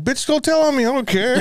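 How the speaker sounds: high, laughing retort; mock defiance. Boyfriend retorting about the therapist threat, high mock defiance. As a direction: dismiss her threat in a high, hammed-up voice.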